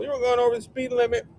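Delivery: quick hammed-up cop line. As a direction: rattle it off like a goofy cop. Acting cop at traffic stop.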